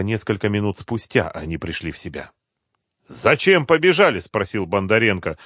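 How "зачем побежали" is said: loud, high challenging question; annoyed demand. A narrator's reading. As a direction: demand to know why. Thug angrily questions the retreat.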